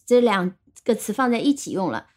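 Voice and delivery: ordinary delivery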